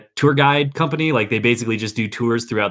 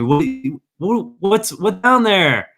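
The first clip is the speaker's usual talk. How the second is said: tourist; 9 semitones higher than usual. Retold or imitated. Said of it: "they'll ask me".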